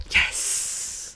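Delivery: hushed, relishing whisper; whispered inner thought. Retold or imitated. Recalling waking up the next day, whispered. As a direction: breathe it out as a private triumph. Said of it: "I just thought".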